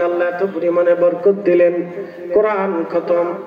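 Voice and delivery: ordinary talk, nothing marked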